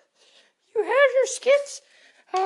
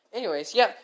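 ordinary talk

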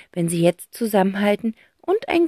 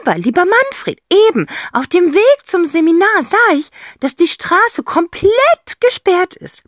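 high, loud, weighty phone talk; mock portentous; meaningful; 11 semitones higher, 7 dB louder than usual. A narrator's reading. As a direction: lay on the significance. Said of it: "sagt sie bedeutungsschwer".

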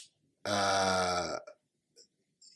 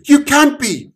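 high, loud protest; shocked disbelief; 11 dB louder than usual. Acted. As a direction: cry out in disbelief. Omen disbelief.

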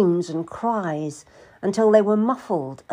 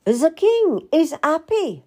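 high-pitched royal observation; queen's marveling remark; 10 semitones higher, 4 dB louder than usual. A narrator's reading. Queen hears king laughing, reacts.

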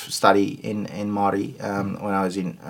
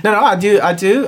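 insistent reply; 12 semitones higher, 8 dB louder than usual. Real, not acted. Defensive after hesitation remark.

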